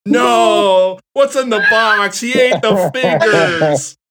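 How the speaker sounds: a hint of a kid reciting a grim movie line; kids quoting. Uncle imitating his nieces and nephews quoting a gruesome ending, slow high mock kid voice. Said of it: "when kids forever just say".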